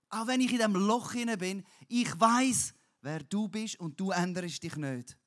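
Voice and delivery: loud, high, earnest plea; crying out to God. Shown as a demonstration. Preacher shows true crying out to God, loud and earnest. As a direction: shout your trust up to God. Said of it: "Schreien zu Gott heisst".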